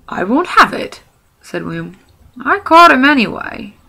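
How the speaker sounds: high, loud troll protest; indignant troll. Acted. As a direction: stake your claim stubbornly. William argues with Bert.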